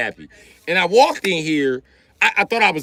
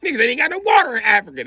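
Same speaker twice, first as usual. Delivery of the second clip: mocking shout; 10 semitones higher than usual. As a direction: shout the taunt up high. Mock taunting across countries.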